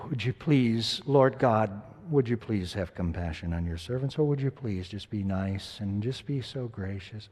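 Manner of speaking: mock-sheepish request, almost shy; a hint of a meek courteous prayer; meek polite plea. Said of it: "And this isn't".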